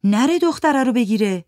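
Giti worried. Acted. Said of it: "گیتی گفت".

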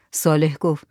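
ordinary delivery

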